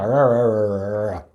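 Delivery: drawn-out string of American "errr" sounds; imitated American R. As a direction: exaggerate the American R over and over. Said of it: "It sounds like".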